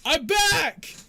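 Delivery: loud, high call of "I'm back"; cheery shout. Acted. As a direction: burst into the room announcing yourself. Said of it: "see Yorn go".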